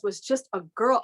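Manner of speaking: ordinary talk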